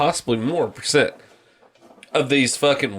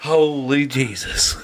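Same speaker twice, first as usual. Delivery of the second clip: movie sergeant; slower than usual. Acted. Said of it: "fucking Full Metal Jacket".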